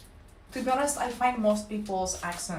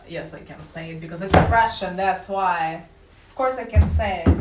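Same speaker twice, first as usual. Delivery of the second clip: loud insistence; 2 semitones lower, 9 dB louder than usual. Real, not acted. Insisting loudly she can say it.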